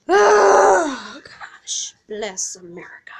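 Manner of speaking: loud cry fading to a whisper; overwhelmed gasp to whisper. Real, not acted. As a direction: burst out, then breathe it out. Overwhelmed exclamation, then whispered.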